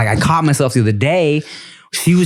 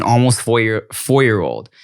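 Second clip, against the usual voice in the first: usual speaking voice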